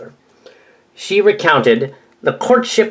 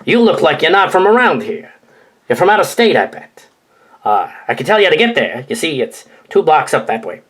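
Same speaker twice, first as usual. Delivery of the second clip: Chicago accent; 2 semitones higher than usual. Shown as a demonstration. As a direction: read it as a Chicago local giving directions. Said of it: "I'll say it like a Chicago accent".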